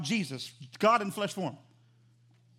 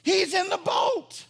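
high, loud, full-force exclamation; impassioned preaching shout; 7 semitones higher, 7 dB louder than usual. Real, not acted. Preacher to congregation on Jesus in the boat, impassioned shout. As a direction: stretch the final words into a shouted climax.

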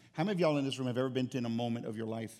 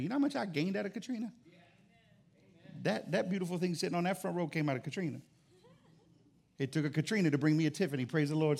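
excited testimony; 6 semitones higher, 4 dB louder, faster than usual. Acted.

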